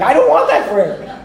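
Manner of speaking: laughing, half-mock refusal; mock horror at the elder's prayer, laughing; laughing protest. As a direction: blurt it out through a laugh. Shocked reaction to the prayer.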